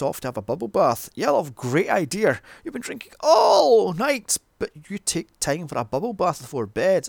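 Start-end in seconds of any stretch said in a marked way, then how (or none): 1.2-7.1 high, loud mock praise; sarcastic. Reviewer scolding a drinker who takes a bath, sarcastic. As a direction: heap on exaggerated approval, pitch leaping up.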